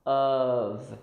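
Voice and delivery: "Of" drawn out as UHV; V ending. Shown as a demonstration. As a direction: model "Of" with a voiced V. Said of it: "It's like a plus a V sound".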